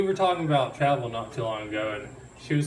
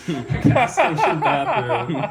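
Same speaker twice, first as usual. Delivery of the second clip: laughing; 5 semitones higher, 5 dB louder, slower than usual. Real, not acted. Laughing through confession.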